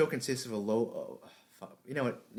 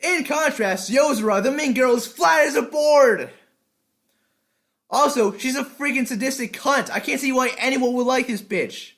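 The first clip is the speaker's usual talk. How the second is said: high, loud, punchy review voice; animated anime-review narration; energetic script read; 12 dB louder than usual. Acted. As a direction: hype up every list point.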